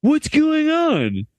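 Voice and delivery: slow, dazed surfer drawl; surfer dude voice. Shown as a demonstration. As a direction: stretch it out like a stoned surfer. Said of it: "I have to read this like a surfer dude".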